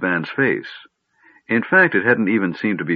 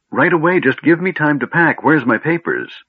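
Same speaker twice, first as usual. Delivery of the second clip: high, loud, eager outburst; excited flurry; 8 semitones higher, 4 dB louder than usual. A narrator's reading. Gnome rushing off to pack, excited. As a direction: rattle off the to-dos, thrilled.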